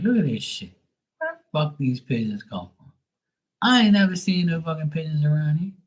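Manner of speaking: mock-stunned, reliving seeing the pigeons; amazed. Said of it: "And I'm like".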